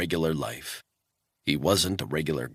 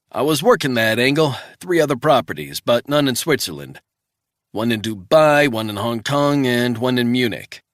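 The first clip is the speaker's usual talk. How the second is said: higher, louder, brisk partner on the phone; a colleague reporting back by phone, brisk and raised; voicing the partner; 5 semitones higher, 6 dB louder than usual. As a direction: rattle off the findings like an eager colleague. Narrator voices Travell on phone.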